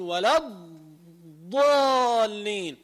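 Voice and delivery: imitating a doubled ḍād in الضالين; الضالين with the ḍād squeezed and then doubled, slow; doubled dad error. Teacher mimics a reciter doubling الضاد in الضالين. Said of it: "بيكمش الضاد، بعدين بيطلع بضاد مشددة".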